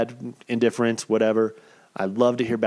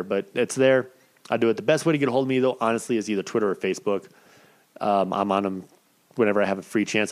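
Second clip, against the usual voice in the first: ordinary talk, nothing marked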